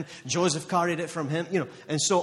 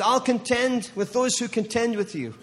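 proclaiming; 7 semitones higher than usual. Acted. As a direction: ring it out as God's promise. Declaring God's promise at peak.

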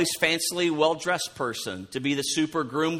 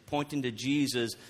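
normal talking voice

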